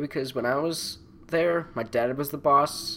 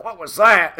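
higher, louder startled question; imitating a startled man; 7 semitones higher, 10 dB louder than usual. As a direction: jump at the sudden bang. Donnie startled in his Jeep.

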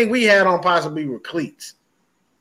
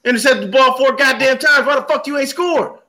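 angry rant; 6 semitones higher than usual. Retold or imitated. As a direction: tear into the quarterback.

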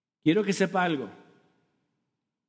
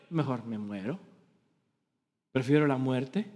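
mock despair; 8 semitones lower, 5 dB quieter than usual. Imagines owing an impossible debt.

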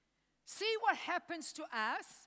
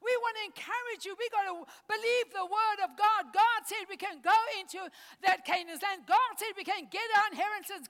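rousing, loud, fast sermon call; fired-up preaching; 3 semitones higher, 5 dB louder, faster than usual. Acted. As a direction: stir the church to take the land. Preaching to congregation.

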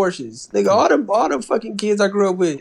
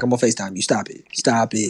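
imitating the friend; 10 semitones lower, 4 dB quieter, faster than usual. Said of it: "and then you was like".

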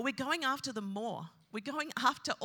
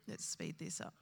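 whispered aside to self, much softer; a quick hushed note to self; muttered aside; 5 semitones lower, 8 dB quieter than usual. Real, not acted. Preacher whispering to himself about time, muttered. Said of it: "Oh, let's speed this up".

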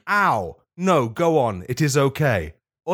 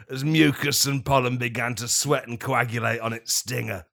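normal talking voice

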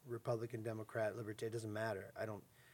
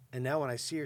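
ordinary delivery

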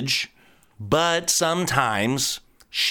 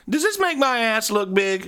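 woman's voice; 9 semitones higher than usual. Retold or imitated. Woman asking joke question.

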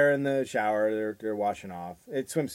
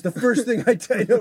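higher, louder macho dad; mock pushy dad; 10 semitones higher, 8 dB louder than usual. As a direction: boom like an overeager father.

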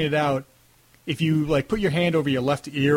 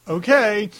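doubtful agreement; 7 semitones higher, 5 dB louder than usual. Retold or imitated. Retold reluctant reply to acting request.

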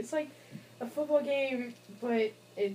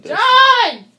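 loud call across the house; shouting a name; 12 semitones higher, 16 dB louder than usual. Real, not acted. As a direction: yell the name out. Calling out to John.

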